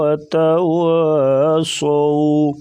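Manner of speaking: slow, melodic tajweed chant; Quran recitation. Shown as a demonstration. Teacher reciting for students, slow melodic tajweed. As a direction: draw each word out slowly in recitation. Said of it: "را کو موٹا پڑھیں گے".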